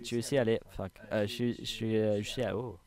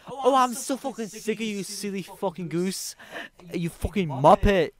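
high mock British yelling, dragging the insults; hammed-up British insults; angry British man; 9 semitones higher, 5 dB louder than usual. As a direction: play the bloke firing back. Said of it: "il lui répond".

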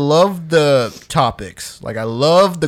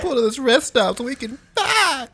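high mock plea; 12 semitones higher than usual. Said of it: "echoing the joke".

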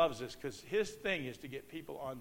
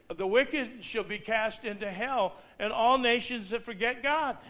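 scripture reading; 7 semitones higher, 5 dB louder than usual. Acted. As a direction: intone the verse like a warning. Quotes scripture aloud.

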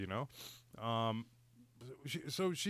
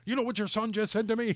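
raised, higher-pitched tattling; retold indignant complaint; 7 semitones higher, 5 dB louder, faster than usual. Dad retells complaining to the boy's mother, indignant. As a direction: report the kid's offence in outrage.

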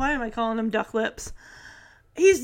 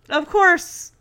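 ordinary talk

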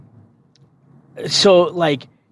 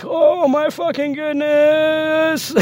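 high, drawn-out mock wail; mock dismay; 11 semitones higher, much slower than usual. Recruit reacting to the drill sergeant's summons, mock dismayed wail. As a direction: stretch it out in high-pitched dread.

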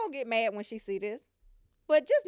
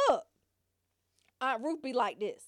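ordinary talk

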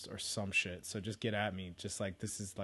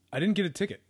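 excited; 9 semitones higher, 8 dB louder, faster than usual. Real, not acted. Excited reveal of no ticket.

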